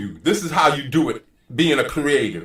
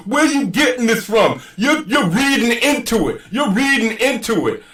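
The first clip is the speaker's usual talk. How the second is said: higher-pitched mimicked protest; imitating his objection; 9 semitones higher than usual. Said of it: "first thing he's gonna say is".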